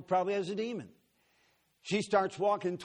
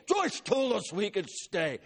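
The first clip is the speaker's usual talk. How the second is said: demon voice; 4 semitones higher, slower than usual. Retold or imitated. Demon answers the preacher.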